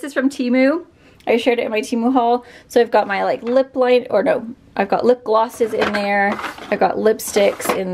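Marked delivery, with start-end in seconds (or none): none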